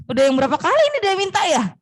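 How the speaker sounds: usual speaking voice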